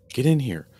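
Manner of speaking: loud, high command; urgent shout. Acted. Mother calling her daughters to get inside, loud shout.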